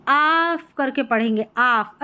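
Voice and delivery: आफ with long 'aa', no L; silent-L pronunciation. Shown as a demonstration. Teacher shows learners the ALF pattern, आफ with silent L. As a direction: draw out आफ as 'aaf'. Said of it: "एल जो है ना ये silent है".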